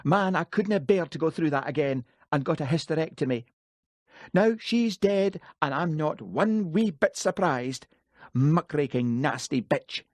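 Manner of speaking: grieving mother lashing out at the dead reporter; Jessie's voice. A narrator's reading. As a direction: spit out the grief and scorn. Jessie's bitter outburst.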